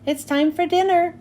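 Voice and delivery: usual speaking voice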